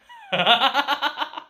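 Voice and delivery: high, rapid giggling cackle; real burst of high laughter; amused cackle. Real, not acted. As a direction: crack up at the story. Cracking up at character's scheming.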